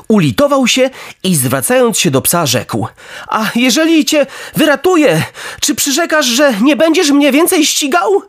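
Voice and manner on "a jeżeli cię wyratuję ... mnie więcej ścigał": high-pitched puppet voice; bargaining puppet. A narrator's reading. Pinokio asks the drowning dog for a promise, high insistent voice. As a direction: put a condition in a high, pressing voice.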